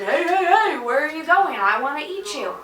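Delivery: sing-song hunter calling its fleeing prey; playful menacing creature call; creepy bear voice. Retold or imitated. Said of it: "His voice. If you could only hear his voice".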